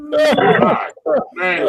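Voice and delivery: high, loud gasp of amusement; laughing exclamation. Real, not acted. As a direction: let the laugh spill into the word. Recovering from laughter.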